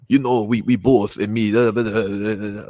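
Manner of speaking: imitating a Geordie speaker, garbled; rough Geordie impression; Geordie accent. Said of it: "I don't understand what the hell".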